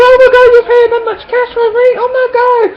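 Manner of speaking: fast, very high mock squeal; hammed-up squealing disbelief; shocked friend. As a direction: imitate an aghast friend in loud falsetto. Same shocked friend, said over.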